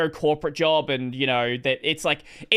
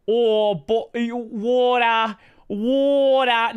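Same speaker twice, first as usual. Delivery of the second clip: mock Aussie accent; 5 semitones higher, much slower than usual. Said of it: "make fun of my accent".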